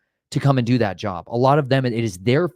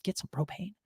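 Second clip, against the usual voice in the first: much higher, softer sweet-innocent ask; high, soft, hammed-up innocent request; 10 semitones higher, 10 dB quieter than usual. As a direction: play it like you've never bought propane. Retold ask to new cashier.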